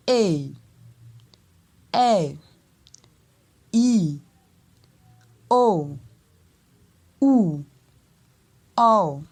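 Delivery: each vowel "A, E, E, R, I, O, U, O" starting high, then gliding down; falling contour tone. Shown as a demonstration. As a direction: go up, then slide down on every vowel. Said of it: "à monter dans l'intonation et à descendre".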